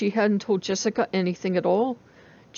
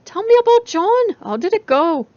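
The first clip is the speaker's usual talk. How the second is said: put-on much higher, loud girlish voice; eager friend; 12 semitones higher, 7 dB louder than usual. A narrator's reading. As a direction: beg for the gossip.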